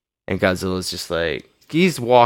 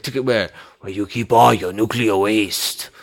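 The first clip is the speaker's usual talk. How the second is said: mock Japanese accent. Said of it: "my really offensive, uh, Japanese accent".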